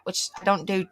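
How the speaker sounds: with the hiccups; hiccuping between words. Real, not acted. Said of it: "I got the hiccups".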